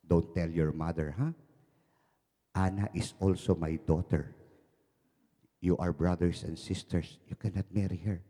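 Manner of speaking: father lowering his voice to his son; secretive father. Acted.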